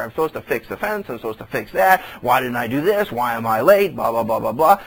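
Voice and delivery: low, weary mock complaint of being nagged; a husband dreading the nagging at home, low mock grumble; mock grumbling husband. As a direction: grumble it like a tired husband mimicking his wife. Husband mimicking his nagging wife.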